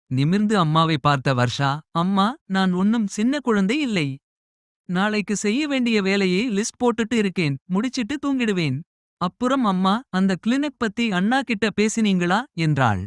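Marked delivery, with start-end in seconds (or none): 1.9-12.4 put-on higher young girl, a touch defensive; lightly voiced daughter insisting she is grown up. Daughter answering her mother late at night, higher young voice.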